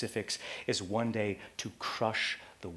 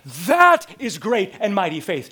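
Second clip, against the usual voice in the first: declared loudly; 8 semitones higher, 13 dB louder than usual. Real, not acted. Triumphant summary.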